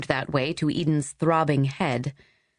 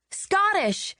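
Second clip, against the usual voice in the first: much higher, crowing with triumph; triumphant. Acted. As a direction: announce it like you've won a guessing game. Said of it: "she said triumphantly".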